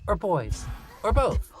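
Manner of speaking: put-on high teasing kid voice; child voice. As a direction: needle him playfully. Lucy teasing.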